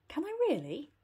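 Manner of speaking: light up at the idea; pleased. Acted. Heidi pleased about a present for Granny, delighted. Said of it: "pleased with this idea".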